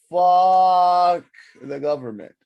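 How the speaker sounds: loud, long drawn-out first word; mock defiant shout. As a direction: bellow it slowly as a punchline. Mock defiant punchline to story.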